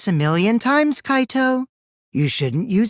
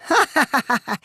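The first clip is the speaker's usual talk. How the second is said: high, gleeful cackle; a high mocking giggle at the beaten hero; mocking laugh; 6 semitones higher than usual. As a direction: laugh at his struggle. Villain laughing at Kaito.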